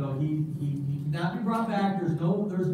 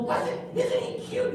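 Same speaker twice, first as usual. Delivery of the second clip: put-on high, breathy kid gushing over his fish; a happy little boy in love with his goldfish; high puppet kid, slowed, gushing; childlike puppet voice. Puppet Gus speaks.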